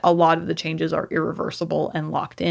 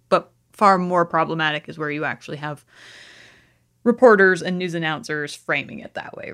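ordinary talk

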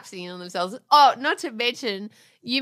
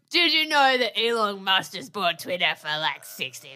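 mock husband voice; 6 semitones lower than usual. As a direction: parody him droning the news at breakfast. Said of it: "That's not how I said it".

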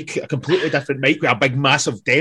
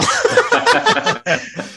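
a sudden, high, real laugh; loud laugh after the tale; high burst of laughter; 3 dB louder than usual. Real, not acted. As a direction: crack up at your own story. Laughing at own story.

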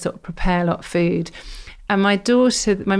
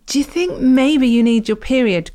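put-on higher, louder, exasperated teen telling her mum off; teenage daughter; 6 semitones higher, 4 dB louder than usual. Daughter telling stressed mum off, exasperated. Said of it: "She went".

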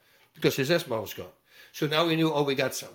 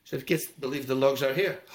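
ordinary talk

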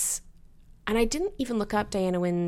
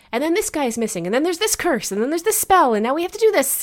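mock breathless plot rundown; 7 semitones higher, 8 dB louder, faster than usual. Rattling off a hectic plot.

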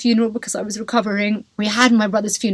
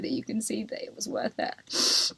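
soft, hushed sincere wish; quiet, heartfelt words to the viewers; whispered hope; 9 dB quieter than usual. Real, not acted.

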